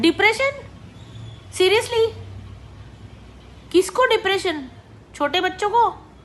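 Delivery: real outrage at the parents' depression claim; incredulous disbelief. Real, not acted. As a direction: react as if it's absurd.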